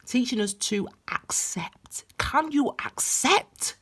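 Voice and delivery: soft unvoiced whisper; hushed coaxing whisper. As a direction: breathe the words like a secret. Tarot reader coaxing viewer inwardly.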